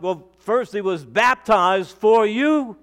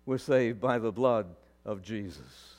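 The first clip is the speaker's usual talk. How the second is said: Peter's quiet reply; 10 semitones lower, 9 dB quieter than usual. Pretend. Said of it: "And Peter would probably say".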